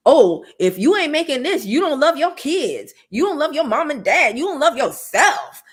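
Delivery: loud, high mock preacher; mocking hustle guru. Mocking guilt-tripping money gurus with a loud, high, preachy voice. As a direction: sneer like a flashy guru. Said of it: "making it seem like".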